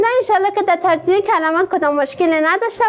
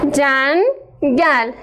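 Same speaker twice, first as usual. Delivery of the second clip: جنگل split slowly as جن - گل; syllabified slowly; 2 semitones lower, much slower than usual. Shown as a demonstration. (هجا کردن آهسته جنگل)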